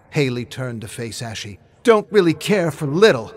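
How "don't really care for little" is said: defiant retort. Acted. Haley retorts to the killer.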